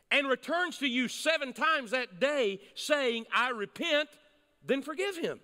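high, deliberate preacher's reading; emphatic scripture reading. Acted. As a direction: proclaim the verse, pausing between phrases. Reads scripture to congregation.

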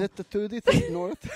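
high, loud, exaggerated lisp on "Sit". Shown as a demonstration. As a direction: lisp every s sound, hammed up. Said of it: "Why are you so lispy?".

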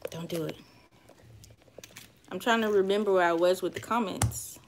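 ordinary talk

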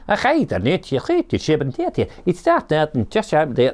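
mock-incomprehensible broad Scottish, pitched high; broad Scottish accent. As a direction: imitate an impenetrable Scottish local giving directions. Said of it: "all in this incredibly broad Scottish accent".

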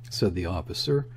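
ordinary delivery

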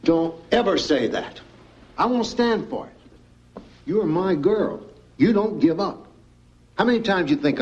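high, emphatic fatherly urging; firm, impassioned encouragement; insistent pep talk. Acted. Father refuses daughter's giving up.

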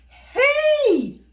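high, loud shout after a run; panting shout of hey; breathless call. Acted. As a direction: call out, chasing him. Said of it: "Little Chow panted".

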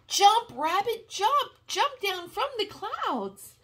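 high excited call to the rabbit. Acted. Grandma reading to grandchild, urging the rabbit on. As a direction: urge it to jump, high and bright.

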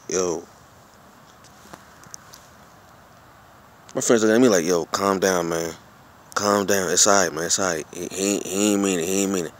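soft, low calming voice; quiet reassuring calm down, man; soothing friend. Retold or imitated. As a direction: settle him down gently, man to man. Friend calming him down at table.

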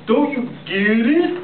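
high, slow exasperation; exasperated. Acted. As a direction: spell it out slowly, annoyed. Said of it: "said the other naked Morats".

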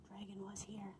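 whispered, secret and mischievous; hushed prankster whisper; sneaky whisper. Acted. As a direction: breathe it like a naughty secret. Dragon's graffiti read out.

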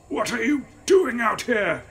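strained, high, loud croak of a man in pain; croaking injured man. A narrator's reading. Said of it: "he croaked".

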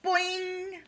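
bright springy boing noise; high loud boing. Acted. Jump sound in story.

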